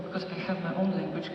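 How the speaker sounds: usual speaking voice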